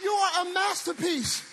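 loud, high, sung-out preacher cadence; full-force preaching. Acted.

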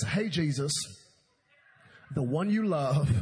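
loud, deliberate, broken phrases; dictating a text. Acted. Martha texts Jesus about her brother, emphatic dictation. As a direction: dictate it pointedly with long pauses.

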